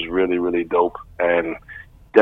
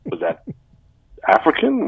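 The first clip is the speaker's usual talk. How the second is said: ordinary talk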